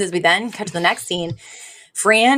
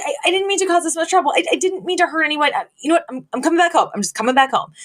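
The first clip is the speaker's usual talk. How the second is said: flustered daughter; 8 semitones higher, much faster than usual. Retold or imitated. Guilty daughter gives in.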